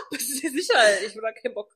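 laughing through the quote. Retold or imitated. The friend repeats the line, laughing. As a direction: giggle it out. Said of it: "laughing, quoting".

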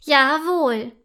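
loud, strict one-word reply; firm, reproving mushroom; stern. A narrator's reading. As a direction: confirm it sternly. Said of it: "antwortet der Pilz streng".

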